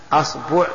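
"أصبع" said as asbu', a then u; opening a, middle u. Shown as a demonstration. Teacher lists variants for students, "أصبع" as asbu'. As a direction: say "أصبع" with fatha on hamza, damma on ba. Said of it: "الفتح وال-، والضم والكسر".

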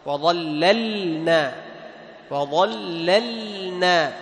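"وظللنا" drawn out very slowly with the lam clearly sounded. Shown as a demonstration. Teacher modelling the correct reading for the class. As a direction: stretch "وظللنا" and keep the lam separate from the nun. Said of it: "تظهر اللام عند النون".

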